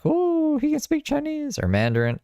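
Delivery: very high, amazed squeal. Retold or imitated. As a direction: squeal it out in wonder. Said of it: "And then they're like".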